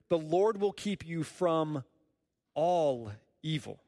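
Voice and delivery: "all evil" slow and weighted on "all"; emphasis on all. Shown as a demonstration. Preacher slowly stressing all evil to the congregation. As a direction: dwell on "all".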